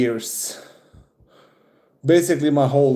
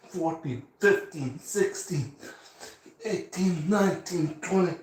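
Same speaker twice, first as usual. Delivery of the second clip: counting through the effort of push-ups, climbing higher; strained counting; 5 semitones higher, 7 dB quieter than usual. Real, not acted. Counting his push-ups on camera, strained and rising in pitch. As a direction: squeeze each number out as the reps get hard.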